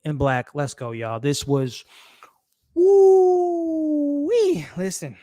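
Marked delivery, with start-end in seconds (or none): 2.7-4.6 loud, high, long "woo-wee"; high drawn-out whoop. Real, not acted. Host reacting to the episode just watched, whooping.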